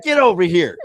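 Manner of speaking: high, loud mock call; eager, affectionate holler; playful summons. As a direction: call them over for a big hug. Acts out urge to hug.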